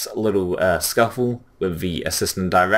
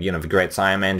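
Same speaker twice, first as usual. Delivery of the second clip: ordinary talk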